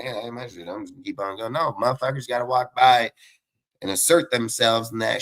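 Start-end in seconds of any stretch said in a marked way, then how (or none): 0.0-1.5 lazy mumble. Retold or imitated. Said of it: "everybody's like".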